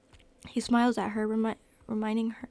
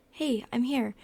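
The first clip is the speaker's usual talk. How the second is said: ordinary delivery, nothing marked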